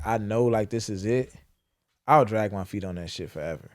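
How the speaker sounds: ordinary talk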